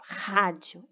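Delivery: "Rádio" with an H-like first R; strong initial R. Shown as a demonstration. Teacher giving another example, "Rádio" with strong initial R. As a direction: start "Rádio" on a strong back-of-throat R. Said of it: "É um som forte".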